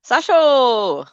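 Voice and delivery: "Sasho" high and loud, last vowel stretched; mimicked name ending. Friend trying the nickname rule, drawn-out "Sasho". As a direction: call "Sasho" with a long final vowel. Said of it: "they'll add an "oo" on to the end".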